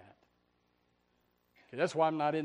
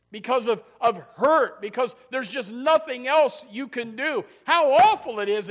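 loud, higher cry about the pain; preaching at full force; 9 semitones higher, 15 dB louder than usual. Acted. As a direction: pour out the anguish in a rising shout.

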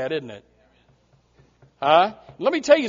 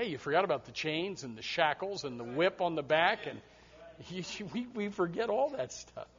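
usual speaking voice

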